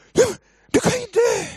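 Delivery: very high, loud panicked scream; re-enacted scream. Father to daughter on the roof, panicked scream. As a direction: scream it out, out of control. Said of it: "Jag bara skrek".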